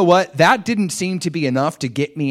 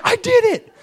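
much higher, louder victory cry; triumphant; 6 dB louder than usual. Retold or imitated. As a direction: celebrate a small win. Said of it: "But I was like".

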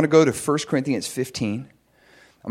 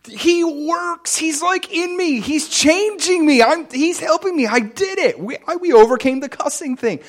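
much higher, joyful shouting; overjoyed. Retold or imitated. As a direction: cry out in happy amazement. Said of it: "I was like".

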